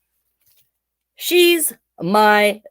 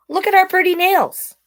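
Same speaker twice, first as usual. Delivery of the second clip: bright, higher, admiring; delighted; 13 semitones higher than usual. Real, not acted. Showing the book's picture to the kids, delighted. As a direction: show them off with delight.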